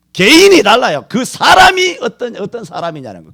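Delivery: loud, high, hammering sermon voice; preaching at full force. Acted. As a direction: shout the point home to the congregation. Preaching climax. (온 힘을 다해 외치는 설교)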